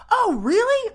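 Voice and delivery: high-pitched "oh really?" reaction; mock surprise. Townsfolk hearing the sheriff's excuse, in high mock surprise.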